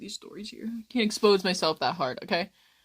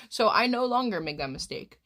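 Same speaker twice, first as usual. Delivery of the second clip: normal talking voice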